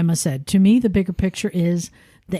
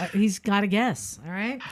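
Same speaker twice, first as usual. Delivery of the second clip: normal talking voice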